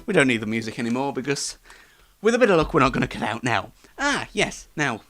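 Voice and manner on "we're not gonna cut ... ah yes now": ordinary talk, nothing marked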